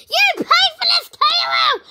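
high, shouted threat with a tearful edge; angry high shout. Pretend. Prank-call victim yells back at caller, furious high shout. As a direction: yell it up high, furious and near tears.

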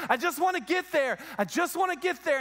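high-pitched, stubborn insistence; insistent mock protest. Swimming disciple refuses to climb into the boat, insistent.

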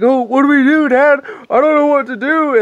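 high, loud imitation of a panicked kid; freaking-out kid. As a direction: wail it helplessly to Dad. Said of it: "everybody's freaking out".

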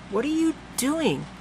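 put-on high, slow girl's question; young girl voice. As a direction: ask it, puzzled, as a child. Girl voice, puzzled.

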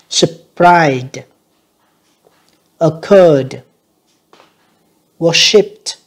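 worshipped ending in a crisp t; ed endings sounded. Shown as a demonstration. Teacher contrasting ed endings for students, careful and clear. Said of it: "âm tờ nha các bạn".

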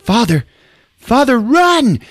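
high, alarmed acted shout; urgent warning. Kid to Reggie about danger, alarmed yell. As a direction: cry out, building to a drawn-out yell.

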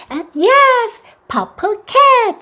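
very slow, high, stretched praise; slow praising expansion. Shown as a demonstration. Mom expanding the child's answer, high and slow. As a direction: model the full phrase gently. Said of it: "앞에 purple 넣어주세요".

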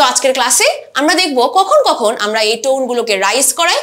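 ordinary talk

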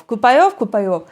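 «Купайов» as a simplified try at the Estonian word; approximated pronunciation. Shown as a demonstration. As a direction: say «Купайов», a Ukrainian-friendly approximation. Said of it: "намагаюся вимовити щось на кшталт Купайов". (спрощена вимова «Купайов»)

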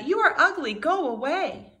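honking bully geese; mean geese. Acted. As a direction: jeer like bossy geese. Mean geese voiced in story.

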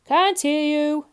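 loud, high, slow shout; booming drawn-out bellow; thundering grocer. A narrator's reading. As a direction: thunder it at the customer. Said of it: "thundered the grocer".

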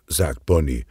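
ordinary delivery, nothing marked

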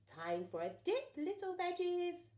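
loud, rising taunt; menacing pea. Acted. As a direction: taunt the vegetables gleefully.

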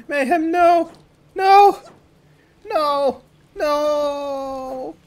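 loud, high cries of protest; dismayed shouting. Pretend. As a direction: cry out to stop a teammate, drawing out the last one. Calling out in dismay at teammate.

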